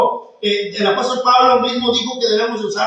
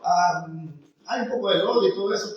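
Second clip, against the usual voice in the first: ordinary talk, nothing marked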